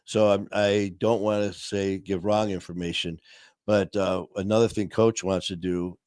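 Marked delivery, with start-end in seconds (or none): none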